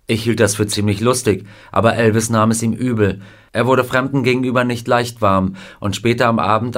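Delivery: ordinary delivery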